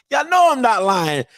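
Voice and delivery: ordinary delivery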